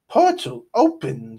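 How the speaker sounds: loud curt order; commanding. A narrator's reading. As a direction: snap the order at the goblins. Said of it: "Salamandra commanded".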